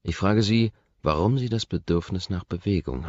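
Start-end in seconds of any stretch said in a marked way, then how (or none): none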